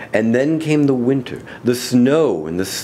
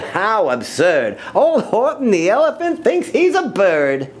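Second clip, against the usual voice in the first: taunting yell; 11 semitones higher than usual. Acted. Friends taunt the elephant sitting on an egg, high and mocking. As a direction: drag out the mockery, then jeer up high. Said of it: "they yelled".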